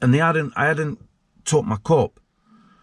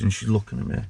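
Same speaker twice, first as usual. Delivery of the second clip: ordinary talk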